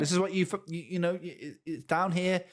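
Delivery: stammering over words, halting restarts; stuttering. Real, not acted. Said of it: "the reason I'm stuttering and stammering".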